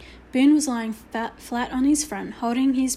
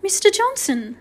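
high-pitched shocked call; 8 semitones higher, 4 dB louder than usual. A narrator's reading. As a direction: call his name in shock. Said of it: "said Missus Hunt in a shocked voice".

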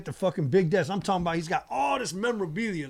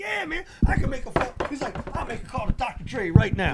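high, excited imitation of a big shot; animated bragging; 9 semitones higher, 3 dB louder than usual. Mogul offers young rapper his connections, animated and high. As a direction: promise big favours in a pitched-up voice. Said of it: "he was excited about what he does".

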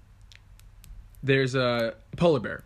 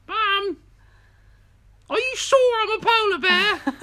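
high, slow, plaintive English cub wail; English baby polar bear; 6 dB louder, slower than usual. Acted. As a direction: pipe up in a piercing English cub voice. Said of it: "I don't know why he's English".

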